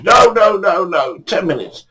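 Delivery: high protest, easing down at the end; pleading refusal. Retold or imitated. Victor asked again to pack up, pleading refusal. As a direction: wave him off in a high voice. Acting Victor begging more fishing time.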